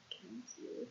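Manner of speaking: ordinary delivery, nothing marked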